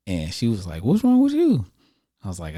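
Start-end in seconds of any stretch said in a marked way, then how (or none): 0.8-1.7 high, loud worried sister; shrill alarmed question. Retold or imitated.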